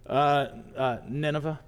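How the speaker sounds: low, halting mumble; sheepish admission. Acted. Jonah answers where he's been, sheepish and low. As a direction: admit it reluctantly, voice dropping.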